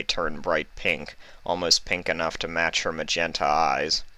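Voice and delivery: usual speaking voice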